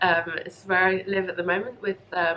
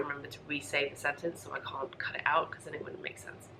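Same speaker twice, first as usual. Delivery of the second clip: trailing whisper; 4 semitones lower, 11 dB quieter than usual. Real, not acted. Quiet aside about coughing.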